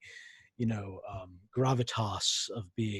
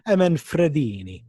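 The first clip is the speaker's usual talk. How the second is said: Italian accent, rolling Manfredini out; 4 semitones higher, 6 dB louder than usual. Shown as a demonstration. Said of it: "Please say it in your Italian accent".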